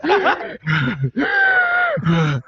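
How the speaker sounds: high, hammed-up gasp; mock gasp. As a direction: fake a dramatic gasp like a bad actress. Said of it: "you could see the woman breathing".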